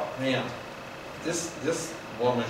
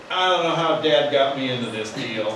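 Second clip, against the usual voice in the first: scornful complaint; 5 dB louder than usual. Retold or imitated. Jezebel complains about her husband.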